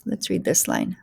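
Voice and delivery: usual speaking voice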